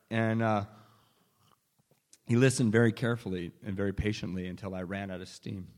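ordinary talk

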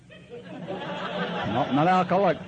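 high laughing no; laughing denial. Retold or imitated. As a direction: laugh off the accusation. Denying through a laugh.

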